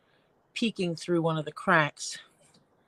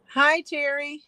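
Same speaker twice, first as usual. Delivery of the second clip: bright greeting; 9 semitones higher, 5 dB louder than usual. Real, not acted. Cheerful greeting to chat viewer.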